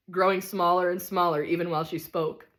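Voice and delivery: ordinary delivery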